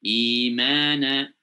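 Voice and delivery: Imana recited slowly with a long stretched vowel; drawn-out recitation. Shown as a demonstration. Teacher demonstrating a long vowel to students, slow recitation. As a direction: draw out Imana like Quran recitation. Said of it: "atau dua harokat".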